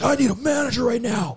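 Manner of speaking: retold yell. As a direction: bark it like an irate caller. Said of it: "starts yelling at me".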